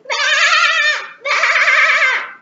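very high, loud goat bleat; the goat joining the call. Acted. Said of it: "Chutki also joined in to say".

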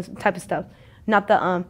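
ordinary delivery

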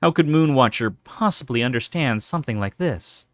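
usual speaking voice

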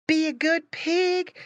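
high-pitched, slow motherly pig voice; mama pig. A narrator's reading. Mama pig to departing Squinty, high-voiced farewell. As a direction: advise slowly in a high mama voice. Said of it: "said his mama".

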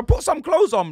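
hammed-up scolding; mock exasperated. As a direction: tell him off in disbelief. Mock scolding Van Damme.

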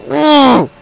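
high loud ooh; startled cry. Real, not acted. Gamer crying out as the car takes a hit. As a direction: yelp at the crash.

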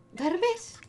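high, rising question at full voice; high-pitched prodding query; insistent. Acted. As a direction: pipe up to rouse the sleeper. Asking the sleeper more insistently.

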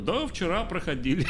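laughing mock quote; mocking viewer voice. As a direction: imitate a clueless viewer with a laugh. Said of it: "А тут чел такой".

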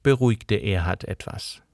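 ordinary delivery

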